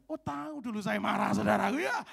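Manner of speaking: usual speaking voice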